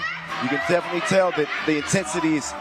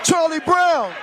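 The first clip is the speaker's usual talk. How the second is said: excited play-by-play; 7 semitones higher than usual. Acted. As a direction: call the action like the crowd's on its feet. Live play-by-play, excited call.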